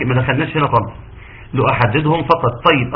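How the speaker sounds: normal talking voice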